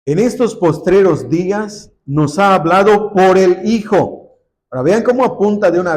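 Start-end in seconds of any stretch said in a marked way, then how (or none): 0.0-4.4 raised, emphatic reading of the verse; scripture reading. Acted. Preacher reading scripture aloud to the church, emphatic. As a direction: read the verse out to the congregation.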